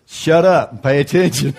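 a lightly voiced blunt God; terse, no-nonsense inner voice. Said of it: "that little still voice that's in there going".

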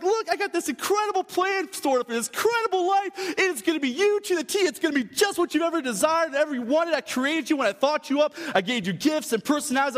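high, excited sales pitch; God's eager offer. Acted. Acts out God offering a plan.

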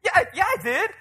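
high, loud defensive protest; yelling back. Acted. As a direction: yell back an indignant denial. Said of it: "yells back, like".

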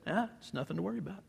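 soft, quick, offhand shrug of a line; casual dismissal. Acted. Elisha calmly waves off his servant's fear, soft offhand dismissal. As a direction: brush it off like it's nothing. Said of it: "in Phil's paraphrase said".

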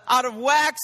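ordinary delivery

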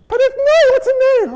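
squeaky comic pestering; very high whiny voice. Retold or imitated. The confused disciple still asking what Nain is, squeaky. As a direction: push the pitch way up, whining.

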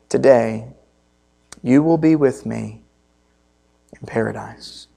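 pastor reading the crucifixion account to the congregation, hushed; scripture reading. Acted. Reads Luke 23 aloud.

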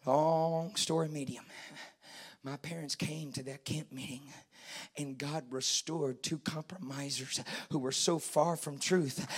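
low, soft preaching; hushed testimony. Real, not acted. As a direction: tell it quietly and gravely.

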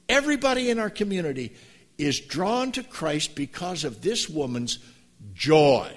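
climbing sermon fervor; preaching at full force. Acted. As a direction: preach it, building to the word joy.

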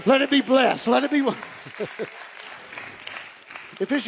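ordinary talk

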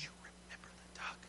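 soft, sly whisper in his ear. Retold or imitated. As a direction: hiss the reminder close to his ear. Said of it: "she whispered really closely in his ear".

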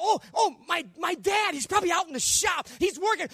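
high, acted anxious realization; anxious hope. Scared boy reassuring himself about his dad, high and anxious.

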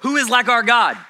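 loud, high, fervent preaching; shouted worship preaching; full-force preaching. Real, not acted. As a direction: lift the room with praise.

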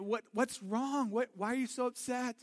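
lightly voiced patient father asking a child what's wrong; gentle dad. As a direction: coax a crying child to talk. Father voicing himself to crying child.

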